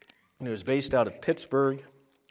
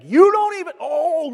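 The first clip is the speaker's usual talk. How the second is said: sudden loud, high mock tirade; mock rant; 16 dB louder than usual. Pastor imagining Mister Rogers ranting at the camera, mock angry shout. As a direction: fake a furious fist-shaking rant. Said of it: "shaking his fist and being like".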